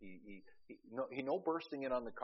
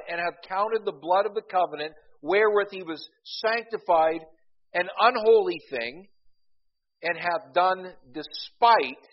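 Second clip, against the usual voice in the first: scripture read with preaching force; 8 semitones higher, 14 dB louder than usual. Acted. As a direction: proclaim the verse to the congregation. Reads Hebrews aloud, preaching force.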